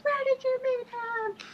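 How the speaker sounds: high, slow demanding wife; high, slow jealous wife; shrill angry wife. Retold or imitated. Wife's shrill retold question.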